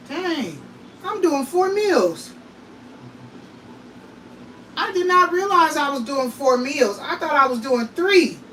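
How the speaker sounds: stunned realization, pitch up; surprised. Real, not acted. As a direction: catch yourself off guard, up and loud. Realizing she cooks four meals.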